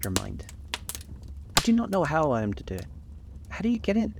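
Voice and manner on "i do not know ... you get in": put-on high, quick little girl voice; innocent girl voice. A narrator's reading. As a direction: play dumb, a touch childlike. Gretel feigns ignorance.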